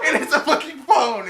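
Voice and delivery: high, very loud laughing shout; hysterical, full-volume disbelief; incredulous yelling. Real, not acted. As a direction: yell the punchline through laughter. Repeats twist, shouting and laughing.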